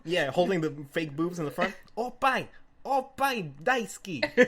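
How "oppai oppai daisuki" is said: higher, slow, louder chanting "Oppai daisuki!"; hammed-up cheer. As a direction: sell it to the crowd. Narrator cheering to crowd.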